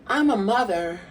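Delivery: high, slow drawn-out diva quote, laughing through. Retold or imitated. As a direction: ham up a proud diva line. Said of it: "[name] was like".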